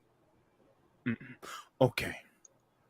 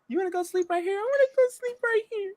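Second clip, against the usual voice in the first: put-on very high, childish sleepy voice; babyish pleading. Teasing over a nap at the table, babyish squeak.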